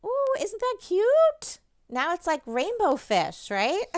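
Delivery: high, loud and slow squeal of delight; delighted gush. Real, not acted. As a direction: coo over it like it's adorable. Admiring the decorated puppet.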